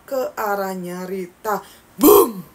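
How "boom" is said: sudden loud boom; loud spooky boom; jump scare shout. Acted. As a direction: hit it hard to startle the listener. Jump scare moment in story.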